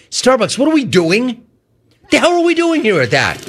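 exasperated rant. Real, not acted. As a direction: pitch climbing into an exasperated cry. Ranting at Starbucks.

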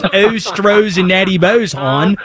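put-on drawn-out Dundalk accent; hammed-up Baltimore local. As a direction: stretch the vowels in a playful Baltimore accent. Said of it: "sounded like you're straight from Dundalk".

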